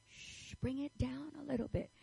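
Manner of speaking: mock a gentle shush; hushing listeners. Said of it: "now people are saying".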